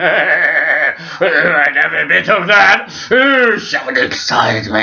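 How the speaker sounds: hammed-up Queen savouring it. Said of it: "the Queen".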